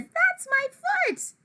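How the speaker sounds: very high, drawn-out protest; startled high-pitched complaint; indignant lady. A narrator's reading. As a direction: squawk it in protest. Lady protests foot being grabbed.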